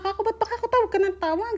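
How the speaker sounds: exasperated retold question. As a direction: throw the question back at him, fed up. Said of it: "inis na inis talaga ako".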